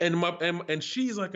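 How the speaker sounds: ordinary delivery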